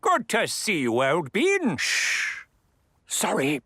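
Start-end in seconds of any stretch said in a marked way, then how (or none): 1.8-2.4 hushing someone in a whisper; a sharp whispered shush. Acted. Hushing in the clubhouse.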